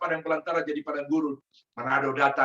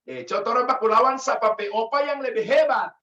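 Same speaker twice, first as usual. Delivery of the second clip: put-on higher, boastful Gorontalo accent; 6 semitones higher, 4 dB louder than usual. Gorontalo man boasting to his Manado friend, Gorontalo accent. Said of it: "ini logatnya orang Gorontalo begini".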